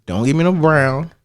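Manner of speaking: ordinary talk, nothing marked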